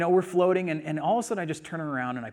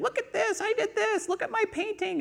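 put-on very high little-child voice; mock a show-off kid demanding attention; squeaky-high, insistent child imitation; bratty braggy kid. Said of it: "nothing more annoying than a bratty, braggy little kid".

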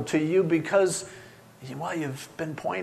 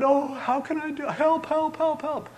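high, panicky cry for help; mock helpless plea; 12 semitones higher than usual. Preacher mimicking someone begging for a method, mock helpless. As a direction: whimper it very high, as a parody.

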